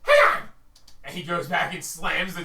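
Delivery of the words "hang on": very high, loud shout; kobold yell. Acted. Kobold yells at the party before slamming the door. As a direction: yell it over your shoulder.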